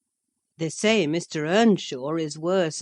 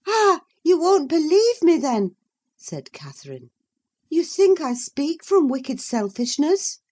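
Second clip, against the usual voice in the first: high, louder, challenging; Catherine's sharp retort; 11 semitones higher, 5 dB louder than usual. Acted. Catherine challenging Isabella, high and sharp. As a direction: press her with the question.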